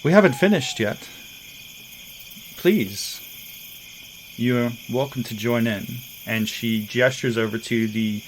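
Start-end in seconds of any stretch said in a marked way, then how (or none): 0.1-6.0 inviting, teasing the guest to join; Kasha's voice. Acted. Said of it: "and, and says".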